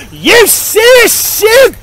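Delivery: slow, high, loud yell; loud drawn-out yell. Real, not acted. Man in car yelling at the viewers, loud and slow. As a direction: stretch it out, yelling at the camera. Shouting at camera after encounter.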